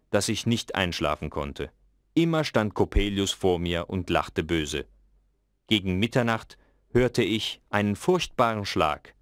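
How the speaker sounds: ordinary delivery, nothing marked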